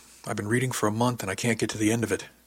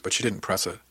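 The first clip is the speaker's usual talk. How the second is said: ordinary delivery, nothing marked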